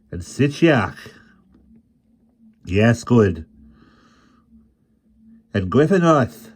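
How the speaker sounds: loud, proud recital of titles; an old bard proudly proclaiming his prince. A narrator's reading. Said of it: "with chilly pride".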